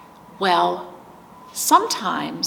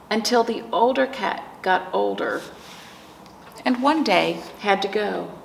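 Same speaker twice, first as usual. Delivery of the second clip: slow, gentle storybook reading with long pauses; reading to children. Acted. Teacher reading a picture book aloud to grieving children, slow and gentle. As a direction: let each page breathe for the little ones.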